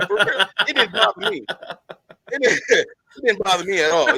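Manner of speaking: amused, laughter breaking up the words; laughing. Real, not acted. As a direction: laugh while insisting it is fine. Laughing after his own Denzel bit.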